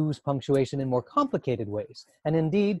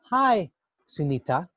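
"Hi, Sunita" with a clear pause after "Hi". Shown as a demonstration. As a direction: say "Hi", stop, then "Sunita". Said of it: "there's a pause between the word Sunita".

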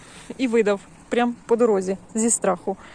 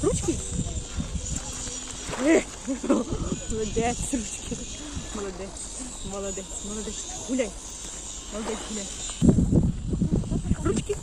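talking to a dog; 7 dB quieter than usual. Real, not acted. Training dog with treats.

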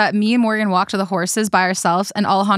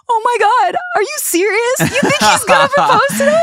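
much higher and louder, squealing with a laugh; fake excitement; 13 semitones higher, 4 dB louder than usual. To the friend who hinted at a proposal, a hammed-up delighted squeal. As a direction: gush like it's the best news ever. Faking surprise about the proposal.

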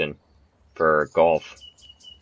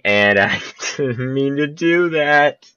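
laughing through the words; 8 semitones higher, 4 dB louder, slower than usual. Real, not acted. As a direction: giggle it out, embarrassed. Laughs at own mistake.

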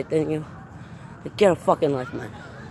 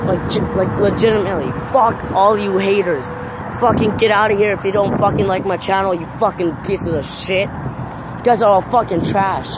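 furious yelling rant; 4 semitones higher than usual. Real, not acted. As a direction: shout them down in real anger. Ranting furiously at online haters.

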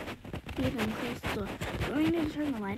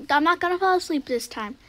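loud, much higher, fast panic; yelling in real alarm to stay awake; panicked shout; 5 semitones higher, 9 dB louder than usual. Real, not acted. Character dozing off again.

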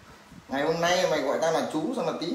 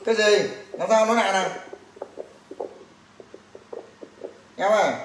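raised phone voice; 4 semitones higher than usual. Real, not acted. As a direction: call out to someone you can't hear. Cannot hear the caller on phone.

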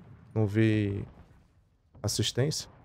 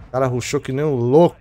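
frustrated outburst; 7 dB louder than usual. Real, not acted. Frustrated after dying.

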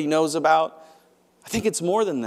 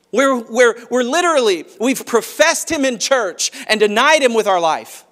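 high, loud, driving sermon climax; preaching at full force; 7 semitones higher, 5 dB louder than usual. Acted. Pastor urging the church, preaching loud and high. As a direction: press the point home to the congregation.

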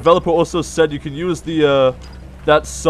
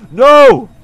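frightened shout; 5 semitones higher, 8 dB louder than usual. Real, not acted. Chickens close in.

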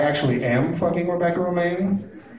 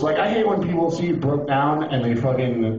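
ordinary talk, nothing marked

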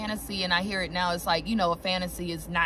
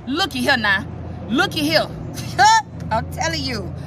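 louder, higher looky here; real thrilled exclamation with a laugh; excited; 6 semitones higher, 8 dB louder than usual. Real, not acted. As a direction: call out a lucky card with glee. Excited by a tarot card.

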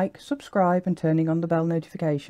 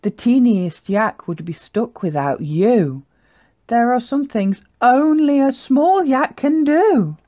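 proud praise, building higher and louder; 6 semitones higher, 4 dB louder than usual. Acted. Herd praising the small yak, proud. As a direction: finish on a rousing, admiring lift. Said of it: "they all grunted proudly".

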